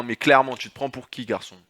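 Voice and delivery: ordinary talk, nothing marked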